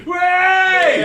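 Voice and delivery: high, loud whoop; excited cheer. Real, not acted. Comic celebrating second gig abroad, cheering. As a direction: shout it out to rally the crowd.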